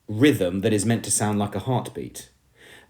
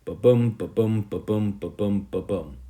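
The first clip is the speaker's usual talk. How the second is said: heartbeat sound. Shown as a demonstration. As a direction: tap out a heartbeat with your voice, letting it die away. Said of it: "implies a heartbeat".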